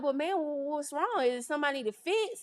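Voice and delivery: a touch of alarm as she recalls the crying client; retold panic. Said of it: "like, panicking at this time".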